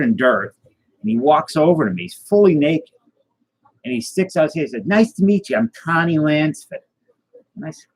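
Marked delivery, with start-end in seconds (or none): 4.9-6.8 imitated Boston introduction, high-pitched; higher-pitched Boston accent impression. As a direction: greet them in a put-on Boston accent. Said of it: "why do you have a Boston accent".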